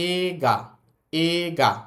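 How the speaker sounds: এ গা with a long এ and no ই; drawn-out vowel. Shown as a demonstration. Teacher demonstrating the Japanese word. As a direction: stretch the first এ, drop ই. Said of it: "এই গা না বলে এটার উচ্চারণ বলবো আমরা".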